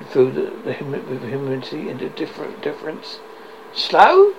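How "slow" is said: high, loud startled echo of the word; incredulous cry. Acted. The ghost echoing Scrooge's word, appalled and loud. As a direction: fling the word back, appalled. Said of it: "the ghost repeated".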